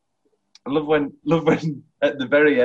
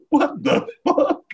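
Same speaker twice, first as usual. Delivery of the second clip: very high, slow, drawn-out disbelief; stunned disbelief; 3 dB louder, slower than usual. Acted. Quoting the film's reply after a slap, high and stunned. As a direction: squeak out each word, astonished.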